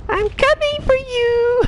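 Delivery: very high, drawn-out cartoon cry; silly falsetto imitation; high-pitched character voice. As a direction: squeal it slowly in falsetto. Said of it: "it's that high-pitched voice".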